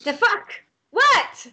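high, sharp in-character outburst; alarmed, high-pitched bewilderment; startled awake. Acted. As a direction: react as if yelled awake. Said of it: "The Bill is yelling".

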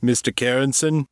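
lightly voiced gruff man calling out; a hint of a gruff male enquiry; gruff caller. A narrator's reading. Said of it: "A voice called. It sounded deep, gruff, and male".

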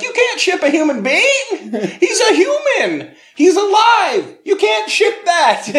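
high, loud, slow outraged shout through laughter; outraged laughing yell. Retold or imitated. Postal clerks refusing to ship the boy, outraged laughing shout. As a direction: protest in disbelief, cracking up. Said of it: "they're like".

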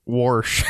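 "Warsh" with an intrusive r; regional "Warsh". Shown as a demonstration. Friend joking about regional speech, exaggerated Warsh. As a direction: say wash as "Warsh", r pushed in. Mock regional pronunciation of wash.

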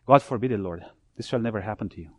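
a shaken Peter pleading under his breath; quiet urgent plea, dying away; hushed tense rebuke. Acted.

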